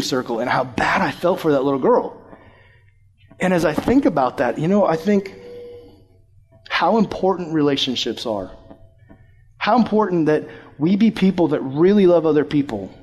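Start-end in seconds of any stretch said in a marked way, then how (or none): none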